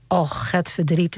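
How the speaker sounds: ordinary delivery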